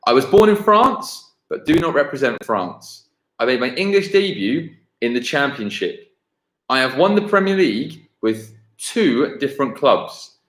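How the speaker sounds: ordinary talk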